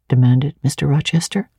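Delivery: plain reading voice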